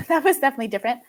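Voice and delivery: quick words spilling out through a laugh. Real, not acted. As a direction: laugh it off, rushing the line. Laughing while recalling pandemic pregnancy.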